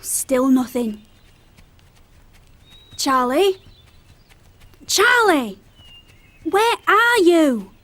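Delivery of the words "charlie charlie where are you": louder calls, slow where-are-you; calling out. Acted. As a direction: shout her name across the valley. Calling out for missing friend.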